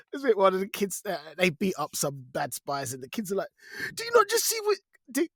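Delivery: normal talking voice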